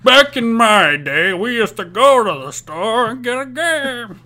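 put-on creaky old-timer, slow and loud; old man voice. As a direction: play a grumpy old man. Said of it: "that old man voice".

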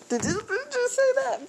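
shocked and offended mother; mom's indignant reply. Retold or imitated. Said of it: "her response wasn't so pleasant".

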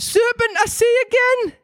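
very high falsetto pigeon; hammed-up Geordie bird squawk. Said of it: "repeated the pigeon".

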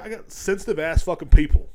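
ordinary talk

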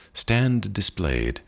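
usual speaking voice

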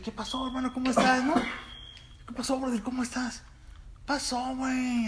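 very high put-on street accent; León slang accent. Imitating childhood friend back from León, high put-on accent. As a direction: greet like a show-off friend. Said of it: "y lo vi".

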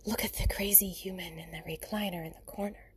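hushed documentary narrator, lightly done; half-joking nature documentary hush; mock nature narrator whisper. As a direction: whisper like a wildlife film narrator. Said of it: "I sent some silly things".

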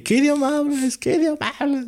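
very high, held-back mock yell; retold dream shout. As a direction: act out screaming in a dream, high-pitched without real volume. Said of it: "empecé a sentir como estaba gritando". (imitando gritos en sueños, muy agudo)

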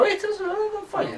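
voz mucho más aguda de señora, suave; voz de señora Doubtfire. Pretend. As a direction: imita a la niñera Doubtfire en falsete. (pregunta a David con voz de señora)

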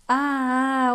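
long, high, loud "aah" scream; loud yell. A narrator's reading. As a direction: yell out hugely in alarm. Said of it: "ordított Norbi a következő pillanatban egy hatalmasat". (hatalmas, magas ordítás)

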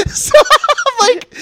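high, loud words breaking into laughter; real laughing. Real, not acted. Comic cracking up over his own arrest fear, laughing hard. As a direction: barely get it out for laughing.

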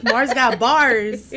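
slow hype-man drawl; big announcer hype. Pretend. Hype line about Mars.

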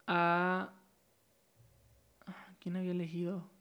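very soft, half-whispered self-question; musing to himself. Real, not acted. Speaker trying to recall whom he chose, musing to himself. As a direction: drop to a real under-the-breath murmur.

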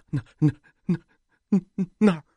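frightened stammering; terrified stammer. A narrator's reading. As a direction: point and stammer in terror. Frightened man pointing, stammering.

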